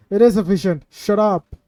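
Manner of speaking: loud, hammed-up protest at chat; playful fake outrage; mock indignant snap. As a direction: act offended, loudly.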